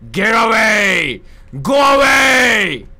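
stretched, very loud yell; long shout. Acted. Player yelling at the monster through the mic, long shout. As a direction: bellow it slowly to scare the thing off. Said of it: "これだけでさ、散策しようぜ".

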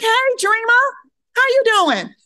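very high, loud sing-song greeting; bright high-pitched phone hello; chirpy phone voice. Retold or imitated. Her chirpy greeting to operators.

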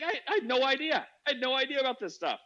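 high-pitched wonder, imitating a friend; acted amazement. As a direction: play his stunned revelation.

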